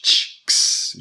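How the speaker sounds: unvoiced bursts, tch then ks; tch and ks sounds. Shown as a demonstration. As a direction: spit out a crisp tch, then ks. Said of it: "Pareil pour le tch".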